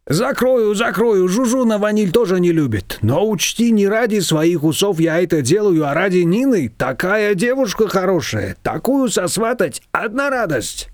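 a chatty confectioner telling tales; confectioner's voice. A narrator's reading.

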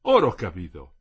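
exclaiming. A narrator's reading. Husband reading tells his wife he has understood, exclaiming. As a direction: burst out with a realisation. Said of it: "Lui esclamò".

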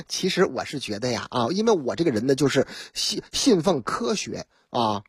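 a hint of a muddled old man making excuses; mild mock defendant, dropping the voice; sheepish defendant. Defendant Sun answers the judge.

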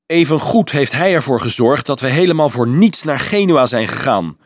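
indignant, angry counter-argument; furious retort. Acted. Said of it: "wierp Peter woedend tegen".

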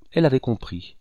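ordinary delivery, nothing marked

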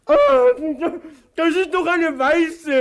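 high, loud protest; outraged at the wrong carrot; surprised complaint; indignant surprise. Acted.

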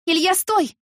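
high loud cry of stop; shout. Acted. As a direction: shout his name to stop him. Heroine yells to stop him.

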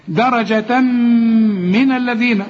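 slow درجة من, tanween merged into a long m hum; idgham with ghunna. Shown as a demonstration. Teacher shows idgham in درجة من, humming the meem long. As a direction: hold the م of من as a hum. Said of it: "إدغام بغنة".

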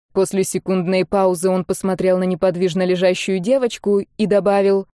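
usual speaking voice

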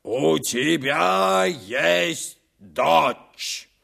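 slow, distant echoing voice; cave echo. Acted. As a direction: let it ring back like an echo. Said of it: "И эхо отозвалось".

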